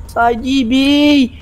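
slow, stretched cry; drawn-out plea. Real, not acted. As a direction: drag out the plea. Begging pursuers to leave.

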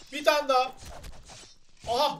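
ordinary talk

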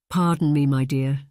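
usual speaking voice

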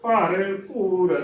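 ਭਰਪੂਰ spoken slowly and carefully, clearly syllabled; slow correct pronunciation. Shown as a demonstration. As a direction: draw out ਭਰਪੂਰ syllable by syllable, no hard bh burst. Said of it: "ਭੱਬੇ ਨਾਲ ਕਰਾਂਗੇ ਤਾਂ ਗਲਤ ਉਚਾਰਨ ਹੈ".

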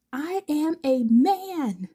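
high, slow, drawn-out protest; high slow indignant man-claim. Acted. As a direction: insist on it like a sulking kid. Hawks protests being called baby.

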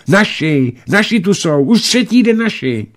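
put-on high, eager children's cry; excited blurting. A narrator's reading. Two boys blurt news to the pilot, excited. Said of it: "Oba vyhrkli najednou".

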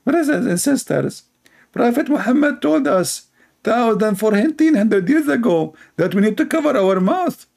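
high, preachy mock sermon voice; mock preacher. Mocking a preacher to his audience, high and preachy. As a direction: ham it up like a preacher lecturing.

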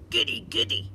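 breathy gleeful snort; gleeful slime snorting. Acted. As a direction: snort with glee. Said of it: "snorted Slime".

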